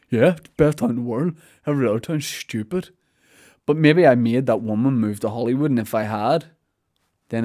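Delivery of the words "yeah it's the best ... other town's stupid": put-on sulky little kid voice; childish. Mocking himself for bragging about his town, childish voice. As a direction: imitate a boastful child. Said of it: "I sounded like such a child".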